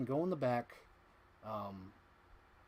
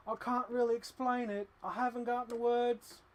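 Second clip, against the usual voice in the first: higher, lightly put-on quoted voice; quoted line; 10 semitones higher than usual. Working a favorite quote into the podcast, higher voice. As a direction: slip in the famous line playfully. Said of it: "finally worked it into the podcast".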